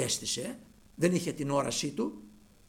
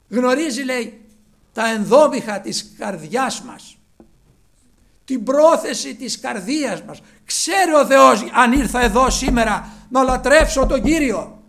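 loud, high, rousing sermon voice; preacher calling out at full voice; full-force preaching; 8 semitones higher, 14 dB louder than usual. Real, not acted. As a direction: proclaim the good news to the whole congregation. Exhorts congregation at full force.

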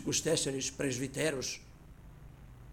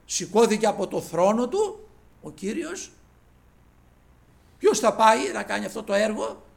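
loud, raised pulpit proclamation; preaching at full force; 7 semitones higher, 12 dB louder than usual. Acted. Preacher telling the brethren the Lord rose, loud preaching. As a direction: lift the story up to the rafters.

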